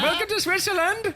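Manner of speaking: high, slow, badly faked Swiss accent; mock Swiss accent. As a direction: put on a silly, off-target Swiss accent, drawn out. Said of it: "Do they sound like that in Switzerland?".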